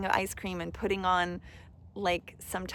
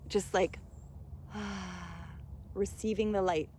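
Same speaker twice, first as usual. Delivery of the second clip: usual speaking voice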